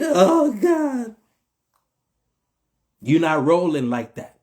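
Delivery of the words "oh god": exasperated groan. Real, not acted. Exasperated exclamation after mock plea.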